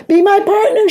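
high, loud excited call. A narrator's reading. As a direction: call back while running off. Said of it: "she called".

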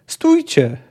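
high, urgent cry to halt; shouted command. A narrator's reading. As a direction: call out sharply to stop them. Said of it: "krzyknął, zaciskając pięści". (wysoki krzyk nakazu)

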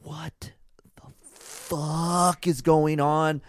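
drawn-out whispered disbelief; hushed, slow-motion bewilderment; stunned whisper. Retold or imitated. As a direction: whisper it slowly, stretching the swear word. Stunned reaction acted in retelling.

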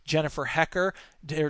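usual speaking voice

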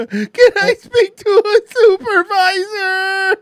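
squeaky, slow, laughing plea; high laughing plea. Pretend. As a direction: beg for a supervisor through laughter. Beaten caller on hotline.

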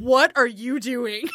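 slow, drawn-out mock shout while leaning back; slow-motion line. Dodging his kiss, she drags out a slow mock yell. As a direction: stretch every word like in slow motion. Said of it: "Slow motion".

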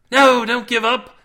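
loud, high, drawn-out urging; urgent plea. A narrator's reading. Cade urges Cindy not to despair, loud plea. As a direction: plead with her loudly.

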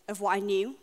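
ordinary talk

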